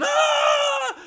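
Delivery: very high, loud hammed-up scream; wife dragged by the demon screams; mock scream. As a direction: shriek like a horror movie victim. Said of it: "she's hanging onto the fucking stairway going".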